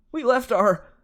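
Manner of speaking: panicked, breaking off mid-word; alarmed cry. Acted. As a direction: Grover crying out in alarm. Grover cut off in alarm.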